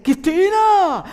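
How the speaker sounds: yelling a name. Retold or imitated. A husband yelling his wife's name mid-argument, a loud shout. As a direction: call her name in a furious yell. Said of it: "yo estoy gritando como".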